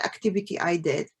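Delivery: ordinary delivery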